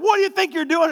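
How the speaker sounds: high, loud what do you think you're doing; mock angry shout. Father confronting his son on the kitchen floor, mock angry shout. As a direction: confront him, hammed-up.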